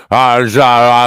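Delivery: loud garbled noise mimicking awful audio; loud wordless imitation of a blown-out track; imitated bad recording. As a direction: blare out a mock distorted playback. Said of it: "It sounded just like that".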